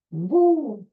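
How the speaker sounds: high whoop; quick wrestler whoop; Ric Flair woo. Retold or imitated. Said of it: "That was his thing".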